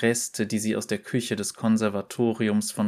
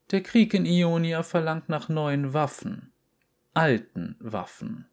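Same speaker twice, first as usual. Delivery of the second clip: a lightly voiced headmistress, higher; a hint of a solemn director announcing fate; headmistress Tercy; 6 semitones higher than usual. A narrator's reading. Headmistress tells the chosen girl about war, lightly voiced. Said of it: "sagte Tercy".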